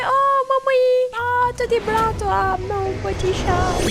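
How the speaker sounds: very high, drawn-out coo; cooing pet talk. Real, not acted. Owner greets her little cat Mimi, high cooing pet talk.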